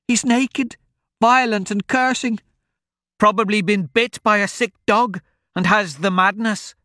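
put-on high old woman's voice; a fretful farm wife pointing the way. Old woman tells the travellers where her farm is.

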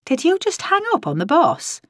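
high-pitched disbelief; incredulous, voice lifted high; aghast question. A narrator's reading. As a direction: ask it scandalised, pitch jumping up. Lingos aghast at the hang-up.

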